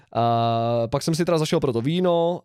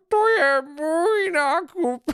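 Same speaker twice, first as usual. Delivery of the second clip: put-on very high, drawn-out wailing old lady; mock an outraged old woman, high and stretched out; rozhořčená stará paní; 13 semitones higher, much slower than usual. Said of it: "mi řekla". (přehnaně vysoký, protáhlý hlas staré paní)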